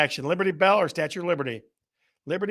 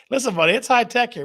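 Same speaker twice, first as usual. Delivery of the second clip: higher and fast, mock-tough; mock boast; 7 semitones higher, 5 dB louder, faster than usual. Teasing Steve about the tech, mock tough. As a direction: puff up like a tough guy, joking.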